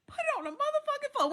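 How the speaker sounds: high, squeaky put-on quote of Diamond; imitating Diamond. Imitating Diamond's catchphrase for viewers, high and squealing. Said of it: "when Diamond say".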